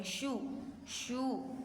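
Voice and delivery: शू with a long, held oo. Shown as a demonstration. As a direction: draw out the oo in शू. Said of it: "ये दोनों हम लंबा पढ़ रहे हैं".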